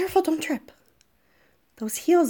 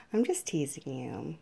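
soft breathy whisper; 6 semitones lower, 8 dB quieter than usual. Acted. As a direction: whisper it close. Soft apology while teasing.

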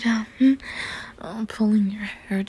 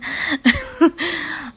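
teasing laugh; 7 semitones higher, 4 dB louder than usual. Real, not acted. Teasing laugh mid scene.

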